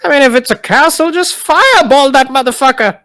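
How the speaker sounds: high, loud brash voice; blunt suggestion. Acted. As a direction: toss it off bluntly. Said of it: "Ryle just looks like".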